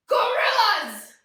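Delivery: loud high gorilla shout. Pretend. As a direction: boom it out like a gorilla. Pretending to be big gorillas.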